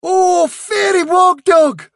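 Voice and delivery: high, loud, drawn-out exclamation; awestruck cry. A narrator's reading. Guard dog greets his supposed fairy visitor, crying out in awe. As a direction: cry it out in overwhelmed worship. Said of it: "cried Roseby Woof".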